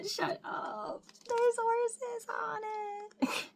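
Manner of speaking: high, slow squeal of disbelief; disbelieving squeal. Real, not acted. Fan finds horses on the stickers, squealing in disbelief. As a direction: squeal it out, stunned.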